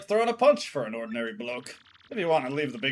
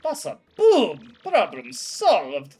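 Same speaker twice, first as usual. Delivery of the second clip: triumphant shout; 3 semitones higher, 7 dB louder, much slower than usual. Acted. Bomb crewmate cheering the plan to the crew, loud and slow. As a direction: bellow it like a victory cheer.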